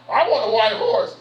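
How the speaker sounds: high, loud, comic eagerness; excited retelling. Retold or imitated. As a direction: call it out like an eager claim. Retold eager claim from last night.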